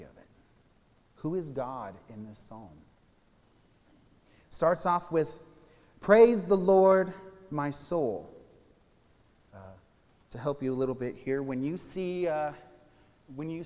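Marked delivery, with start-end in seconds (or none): 6.0-8.3 loud, slow, higher declaration of Praise; proclaiming scripture. Acted. Pastor reads the psalm's first line to the congregation, proclaiming loud and slow. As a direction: ring out the opening praise like a call to worship.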